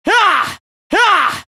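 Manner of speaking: high battle yell; short high yell with the strike; attack cry. Acted. Fighter striking an enemy, high attack cry.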